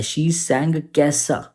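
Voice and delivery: कैसा drawn out very slowly; slow teacher emphasis; stress on कैसा. Shown as a demonstration. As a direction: stretch the word कैसा. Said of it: "कैसा गाया".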